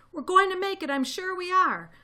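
high, loud encouragement; rallying cry. A narrator's reading. Mister Fox heartening his family, rallying.

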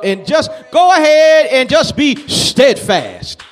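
loud, emphatic exhortation; urgent pulpit charge, key words stretched; forceful preaching. Acted. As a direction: drive each command home at full voice. Exhorts the congregation.

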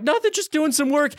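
fake casual cover. Covering up in front of the boss.

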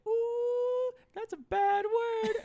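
put-on small child's very high, slow scolding voice; Milo. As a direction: imitate a little kid tattling in singsong falsetto. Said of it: "Milo from the back seat's like".

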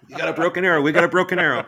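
high, loud shouted alarm call, laughing underneath. Retold or imitated. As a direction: yell it like a code-red radio alert. Alarm call during celebrity drive.

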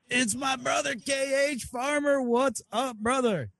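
high-pitched, delighted shout-out without extra volume; hyped welcome for a brother; excited greeting. Real, not acted. Host greets a friend calling in.

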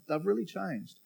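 usual speaking voice

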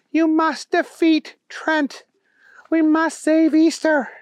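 high, slow, urgent character voice; Elizabeth, horrified plea. A narrator's reading. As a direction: urge the hero on in a high voice. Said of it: "horrified her".

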